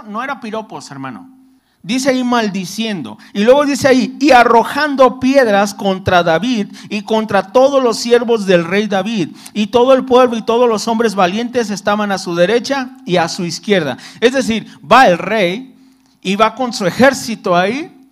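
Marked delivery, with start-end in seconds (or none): 4.2-14.0 scripture read aloud, a little louder; lectura bíblica. Acted. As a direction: read the verse out to the congregation. (leyendo la Biblia en voz alta)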